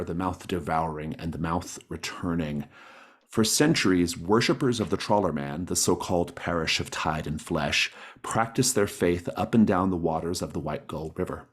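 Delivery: normal talking voice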